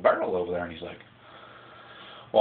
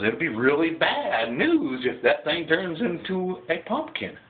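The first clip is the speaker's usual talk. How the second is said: fairy godmother; 6 semitones higher, slower than usual. Retold or imitated. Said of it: "Fairy godmother says".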